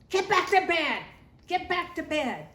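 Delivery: loud, high, stern shout of get back to bed; Dad yelling. Retold or imitated. Dad shouting at kids sneaking downstairs, loud stern yell. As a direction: bark it up the stairs like an annoyed dad. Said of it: "we heard Dad go".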